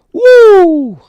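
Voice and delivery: high, loud burst of a whoop at the scale; excited whoop. Real, not acted. Reviewer reacting to a light shoe reading, excited whoop. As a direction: whoop in real delight.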